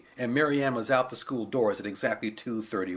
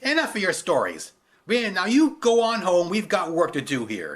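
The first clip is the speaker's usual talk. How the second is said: dismissive guard; 5 semitones higher, 6 dB louder than usual. Acted.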